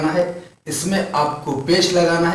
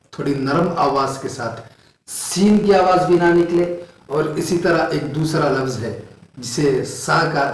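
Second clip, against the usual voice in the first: normal talking voice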